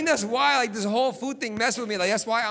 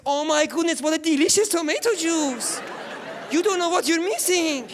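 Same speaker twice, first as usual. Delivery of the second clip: high, loud, gushing toast; hammed-up sisters raving over the juice; over-the-top cheering; 7 semitones higher, 3 dB louder than usual.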